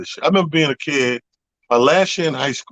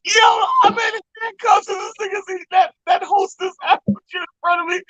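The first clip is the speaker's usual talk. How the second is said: real laughing outcry, pitched way up; incredulous shout. Real, not acted. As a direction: yell it, still outraged and laughing. Outraged laugh recalling cop eating pie.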